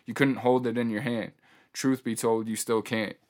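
ordinary talk, nothing marked

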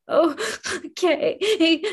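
slow, tearful okay pushed out through sobs; choked little okay to her brother; crying okay. Acted. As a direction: squeeze the word out while still crying. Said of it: "I pushed out".

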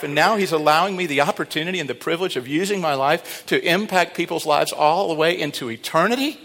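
ordinary talk, nothing marked

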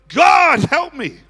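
loud, high shout for help; screamed plea. Retold or imitated. Crowd calling on God, screaming. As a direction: cry out hard on the first word. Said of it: "They screamed".

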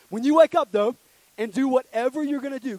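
loud and high preaching; preaching shout. Real, not acted. The youth pastor preaches to the room at full force. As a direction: drive it home to the room.

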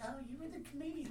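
lightly voiced sweet old lady; a hint of a kindly old woman handing over a lighter. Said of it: "Like, sounded so sweet".

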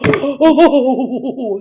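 loud quavering wail of dread; frightened troll. Acted. Troll seeing the boy squeeze rock, terrified.

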